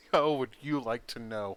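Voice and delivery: cocky, smirking comeback. Acted. Game master's character dodging how powerful she is, cocky. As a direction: answer with a cocky little smirk. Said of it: "A cocky little smirk that says".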